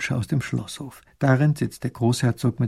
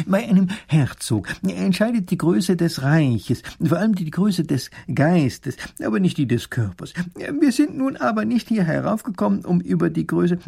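learned professor; 6 semitones higher, 4 dB louder than usual. Acted. Professor voiced.

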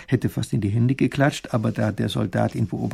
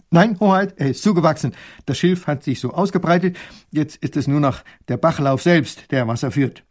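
put-on dutiful young soldier, higher and louder; 3 semitones higher, 5 dB louder than usual. Soldier reports proudly to the princess, eager and dutiful. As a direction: report eagerly like a loyal guard. Soldier explains overgrown pond.

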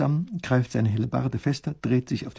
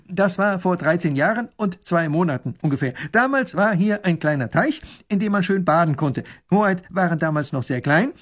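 lightly voiced soldier, higher pitched; eager soldier; 6 semitones higher, 4 dB louder than usual. Soldier recalling the pond for the princess, lightly voiced and obliging. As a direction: report eagerly to royalty.

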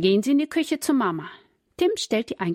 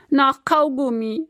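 sobbing; 6 semitones higher, 6 dB louder than usual. A narrator's reading. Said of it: "schluchzt Susi".

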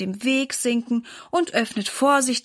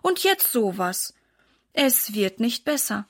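ordinary delivery, nothing marked

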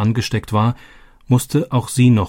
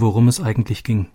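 plain reading voice

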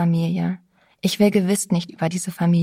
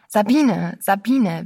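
name called out twice, urgently; urgent appeal; 4 dB louder, slower than usual. A narrator's reading. Narrator seizing Sabine by the shoulders, urgent appeal. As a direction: grab her attention with her name. Seizes her by the shoulders, appalled.

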